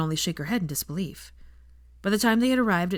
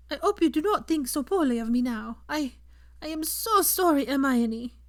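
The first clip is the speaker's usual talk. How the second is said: higher, tearful French-tinged apology; Fleur apologizing; 8 semitones higher than usual. A narrator's reading. Fleur confesses her cruelty to Hermione, high and tearful. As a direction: plead for forgiveness.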